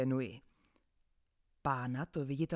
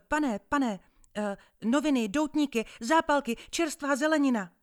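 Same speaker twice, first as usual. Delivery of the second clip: high, loud street-seller's list; hawking loudly; 8 semitones higher, 8 dB louder than usual. Acted. Paperboy calling to a new passenger, high and loud. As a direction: rattle off everything for sale, calling out.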